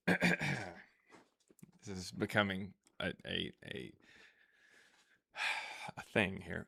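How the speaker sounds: ordinary talk, nothing marked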